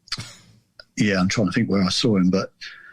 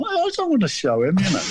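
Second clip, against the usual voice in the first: much higher whiny protest; embarrassed lad protesting; 9 semitones higher than usual. Retold or imitated. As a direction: plead not to show the welt. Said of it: "refusing mum, embarrassed lad".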